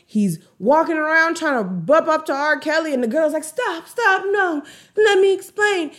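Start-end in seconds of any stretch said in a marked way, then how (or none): 3.4-5.9 high-pitched mock pleading; put-on high, urgent plea; pleading girl. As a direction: imitate a girl begging them to stop. Voicing girl pleading between rivals.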